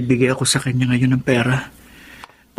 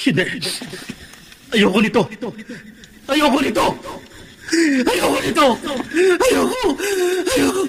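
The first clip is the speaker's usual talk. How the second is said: climbing higher and higher in panic; panicked refusal. Acted. Buboy refusing the bloody scissors, rising panic. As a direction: recoil in mounting terror.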